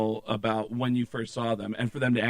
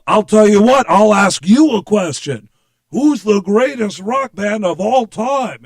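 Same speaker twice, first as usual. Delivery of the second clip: loud, slow, high rock-star boast; 7 semitones higher, 11 dB louder, slower than usual. Retold or imitated. Prank caller quizzing the assistant, pompous rock star. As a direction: lay it down with huge self-importance. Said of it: "clearly you're [name]".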